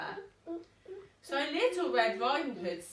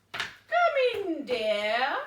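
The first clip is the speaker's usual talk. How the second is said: high, loud fake-nice wolf; sweet on top, menace under; nicest snarl; 4 semitones higher, 5 dB louder, much slower than usual. Wolf luring the girl in, a fake-nice voice. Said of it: "snarled the wolf in his nicest voice".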